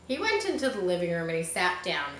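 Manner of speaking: usual speaking voice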